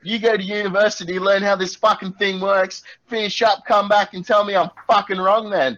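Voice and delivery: furious high-pitched tirade; abusive shouting. Acted. Tech worker sending the farmer to a manager, shouted tirade. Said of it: "at the top of my lungs".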